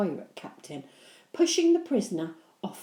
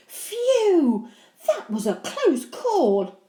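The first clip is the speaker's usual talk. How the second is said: relieved; 12 semitones higher, 10 dB louder, much slower than usual. Acted. Henry relieved.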